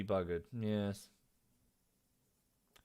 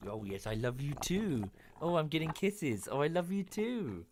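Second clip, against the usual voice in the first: doting pet talk; 4 semitones higher than usual. Real, not acted. Streamer gushes at his French bulldog's kisses, higher and doting. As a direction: melt over the dog's kisses. Dog licking him, he coos.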